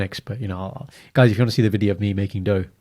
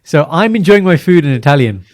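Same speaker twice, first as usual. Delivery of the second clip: excited; 6 semitones higher, 9 dB louder than usual. Shown as a demonstration. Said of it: "few gestures for you".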